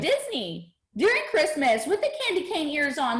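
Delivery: high, giddy quoted voice; excited make-believe. Acted. Speaker voicing a body that believes it's already there, excited. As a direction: voice a thrilled holiday daydream.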